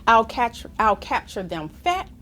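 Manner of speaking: ordinary talk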